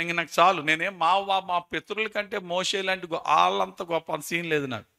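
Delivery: loud, pleading cry of a worn-out prophet; weary Elijah crying out. Acted. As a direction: beg God to let you stop. Preacher acts out weary Elijah. (అలసిపోయిన ఏలియా గట్టిగా మొరపెట్టడం)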